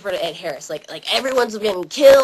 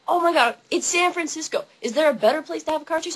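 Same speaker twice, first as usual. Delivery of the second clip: ordinary talk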